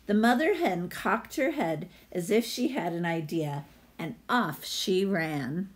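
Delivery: lively read-aloud storyteller voice; story narration. Acted. Teacher reading a picture book to her class, storytelling voice.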